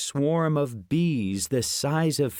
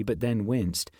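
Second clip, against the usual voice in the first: usual speaking voice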